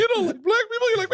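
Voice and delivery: very high, squawking mock accusation; mimicked accuser. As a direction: squeal it like a cartoon heckler. Listener mimics the loud accuser.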